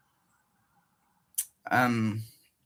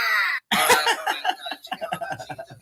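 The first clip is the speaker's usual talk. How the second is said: high cackling laugh; 14 semitones higher than usual. Real, not acted. Streamer cackling at his own disgusting drain clip.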